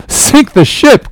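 high, drawn-out outcry; defiant cry. A narrator's reading. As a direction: throw it back as a defiant cry. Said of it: "cried Steelkilt".

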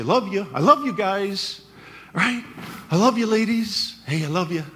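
breezy, careless "I love you" toss-off; flippant "love you". Retold or imitated. Pastor mocking casual church greetings, breezy. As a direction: throw it away like a casual goodbye. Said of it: "as a flippant sort of salutation".

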